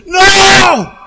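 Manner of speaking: loud, high, sudden yell; urgent warning shout. Acted. Parent with full hands yelling at toddler near stove, urgent shout.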